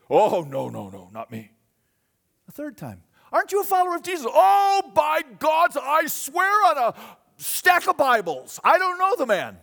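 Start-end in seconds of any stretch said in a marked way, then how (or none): 0.1-1.5 loud denial that drops away low; protest, then shrink back; Peter's denial. Acted. Peter denying the second accuser, loud then fading low. 3.3-4.2 high, loud demanding question; accusing bystander. Acted. Third bystander cornering Peter, high and loud. 4.3-9.6 high, loud shouting oath; desperate oath. Acted. Peter shouting an oath to the crowd, high and desperate.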